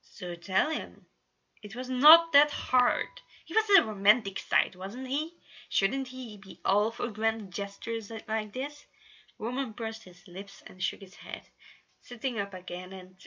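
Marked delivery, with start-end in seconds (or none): 0.0-8.9 slow, louder taunting push; Janus teasing. A narrator's reading. Janus goads Roman to confess, slow and louder. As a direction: needle him slowly.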